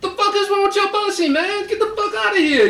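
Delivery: high, fast outburst of outrage; outraged demand. Acted. Man re-enacting his confrontation with the woman, outraged. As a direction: demand it in a high, indignant rush. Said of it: "I gotta say it like this".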